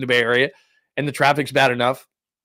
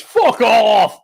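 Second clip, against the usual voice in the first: big mock shout, louder and higher; imitated angry shout; 9 semitones higher, 8 dB louder than usual. The one guy answering the heckler, imitated shout. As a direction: bellow it, imitating the fed-up driver. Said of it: "the one guy that goes".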